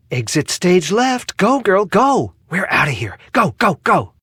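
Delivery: urgent prompting. Acted. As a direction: urge a companion to flee. Urging companion to flee.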